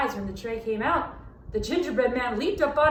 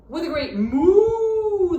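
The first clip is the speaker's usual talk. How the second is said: cow moo; 6 semitones higher, 8 dB louder, much slower than usual. A narrator's reading. Narrator voices cow's moo.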